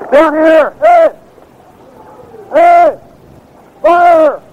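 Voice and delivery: high shouts to draw attention; calling out. Real, not acted. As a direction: yell for the fire crew to come. Calling out to firefighters.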